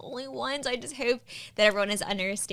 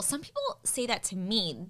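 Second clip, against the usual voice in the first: ordinary talk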